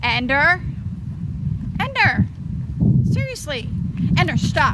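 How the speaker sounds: losing patience with the dog; angry calling. Real, not acted. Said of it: "I'm getting so angry right now".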